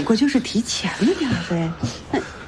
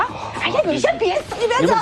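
high, flustered pleading; urgent alarm; 10 semitones higher than usual. Acted. The old aunt begs the man not to leave, flustered. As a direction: stop him in a panic.

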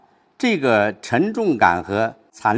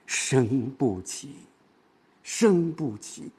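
声不起 soft and drawn out; light-read stress; 5 dB quieter, much slower than usual. Shown as a demonstration. Teacher showing light stress, soft slow 声不起. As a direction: let 声不起 sink softly, very slowly, as if it cannot rise. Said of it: "有的时候重音是轻读的".

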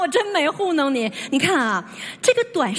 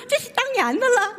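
higher-pitched Cantonese-accented Mandarin; 5 semitones higher than usual. Shown as a demonstration. Explaining the joke to mum, mimicking the shop owner's Cantonese accent. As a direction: demonstrate a Guangdong accent for the punchline. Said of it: "广东话".

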